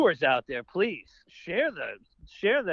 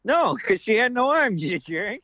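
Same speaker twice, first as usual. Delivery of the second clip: loud, high punchline with a playful scolding; mock exasperation; 5 semitones higher, 9 dB louder than usual. Co-host botched the knock-knock joke, mock exasperated. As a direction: yell the answer as if fed up.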